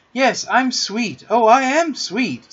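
high, slow, self-satisfied crowing; boastful. A narrator's reading. Peter shows off to the children, boastful crowing. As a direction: draw it out and revel in the praise. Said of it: "forgetting his manners again".

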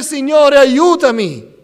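loud, high imploring shout; preacher voicing a father's plea; crying out. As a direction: cry out for help at full voice. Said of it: "Gridò al Signore".

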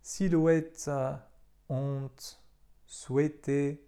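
"silhouette", "honte", "souhaité" with a silent H; teacher showing silent H; French pronunciation. Shown as a demonstration. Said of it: "mogą być".

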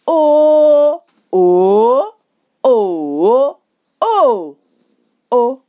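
"o" level, rising, dipping, falling, short; Mandarin tone drill. Shown as a demonstration. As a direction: run "o" through all five tones in order. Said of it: "I put different tones on them".

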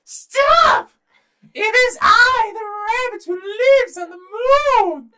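high, loud squeaky little rabbit shout; tiny rabbit's shrill command, words drawn out. Acted. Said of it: "says in his rabbit voice".

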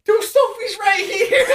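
high, loud, drawn-out panicked cry; freaking out. Retold or imitated. Storyteller acts out finding the cat inside, freaked-out shout. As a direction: shout it in alarm with a laugh behind it. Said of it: "we're all freaking out".

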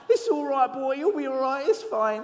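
high-pitched, mock comforting; half-hearted reassurance. Mimes a reluctant fingertip pat.

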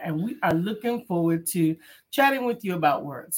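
normal talking voice

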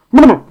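loud high "brr, brr" shiver sound; shudder noise. Retold or imitated. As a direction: shake it off like strong coffee. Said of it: "You're like".

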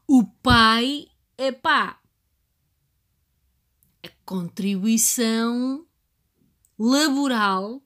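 loud, slow, mocking complaint; emphatic loud rant. Real, not acted. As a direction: hammer home the dad's tiny share. Ranting about father's small part. (desabafo alto e enfático)

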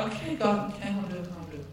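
resigned yield, dying away; reluctant surrender. Retold or imitated. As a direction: trail off, conceding. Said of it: "I'm like".

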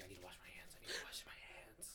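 hushed whisper to herself. Retold or imitated. Kid back home from the mud, whispering about washing hands. Retold self muttering at home.

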